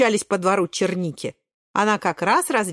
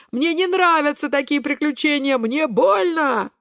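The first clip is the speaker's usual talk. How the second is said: moaning; 7 semitones higher than usual. A narrator's reading. Кабачок hating these adventures, pained high whine. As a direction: whine in pain, stretching the last words. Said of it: "стонет Кабачок".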